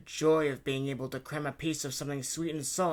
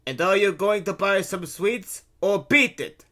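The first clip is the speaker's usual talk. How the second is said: loud, raised vendor's bark; 6 semitones higher, 10 dB louder than usual. A narrator's reading. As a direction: bark it to chase off a loitering customer. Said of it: "Luigi barked".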